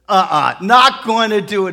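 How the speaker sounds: acted angry refusal; indignant refusal. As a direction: shut the idea down loudly. Husband refuses the retreat.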